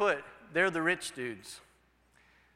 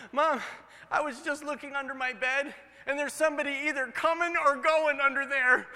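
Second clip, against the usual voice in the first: put-on scared little boy; 7 semitones higher, 3 dB louder than usual. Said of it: "the boy said".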